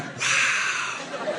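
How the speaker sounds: hushed, breath-only awed wow; soft amazed exhale; impressed wow. Acted. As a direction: whisper it, almost in admiration. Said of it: "he was almost impressed".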